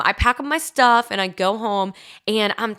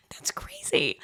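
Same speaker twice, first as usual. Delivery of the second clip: a breathy whispered aside; mock-shocked whisper to the listeners; 10 dB quieter, slower than usual. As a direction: drop to a secret whisper for a second.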